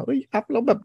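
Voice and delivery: high-pitched mock outcry; put-on alarmed squawk at normal volume; mock fussing. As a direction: imitate a user starting to make a fuss. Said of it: "อย่าเพิ่งโวยวายว่า".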